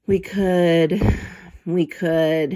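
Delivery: slow, drawn out, fading away; hesitant repeated trailing voice. Acted. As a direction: let the words trail off, lost in thought. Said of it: "his voice trailed off".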